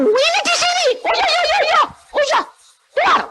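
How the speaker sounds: wife's high voice. Retold or imitated. Wife voiced again.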